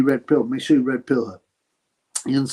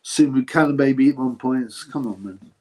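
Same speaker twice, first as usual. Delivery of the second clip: ordinary talk, nothing marked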